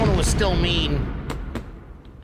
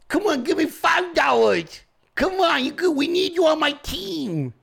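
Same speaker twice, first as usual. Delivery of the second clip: mock begging; 7 semitones higher than usual. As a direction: plead as a crooked-jawed caricature. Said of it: "begging for ten dollars".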